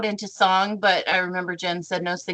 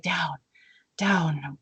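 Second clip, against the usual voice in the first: confused murmur; 3 semitones lower, 6 dB quieter than usual. Retold or imitated. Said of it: "And I was like".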